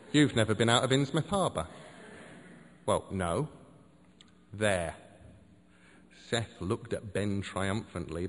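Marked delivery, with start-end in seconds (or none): none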